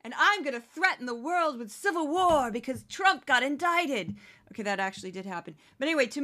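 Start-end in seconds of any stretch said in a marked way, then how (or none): 0.0-4.1 lightly voiced brash politician, higher at the start; Kari Lake impression. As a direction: toss off a mock defiant tirade. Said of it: "Kari Lake would be like".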